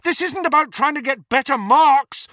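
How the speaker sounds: high, loud, frustrated shout. A narrator's reading. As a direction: shout it at her in protest. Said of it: "Harry shouted at her".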